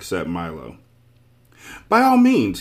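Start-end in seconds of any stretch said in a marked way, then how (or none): 1.9-2.6 high, loud and eager; happy. A narrator's reading. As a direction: burst out with cheerful willingness. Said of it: "he replied happily".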